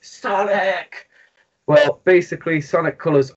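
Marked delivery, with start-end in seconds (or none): 0.0-1.1 mock kid shout. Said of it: "kids are screaming and shouting".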